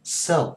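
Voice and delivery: "sale" said as the confusable one-beat "sell"; wrong sale. Shown as a demonstration. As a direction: clip "sale" to "sell" with a lift. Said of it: "người ta sẽ nhầm ra tiếng".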